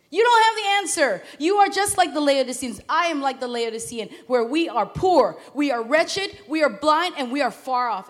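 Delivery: loud, driving sermon climax; fiery preaching. Acted. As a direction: preach it hard to the congregation. Preaching at full force.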